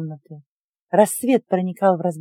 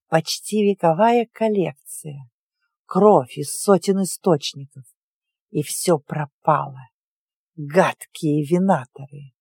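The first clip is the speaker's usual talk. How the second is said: put-on low, slow bitter old witch; 4 semitones lower, slower than usual. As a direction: grieve the loss, then curse. Witch laments ruined blood collection.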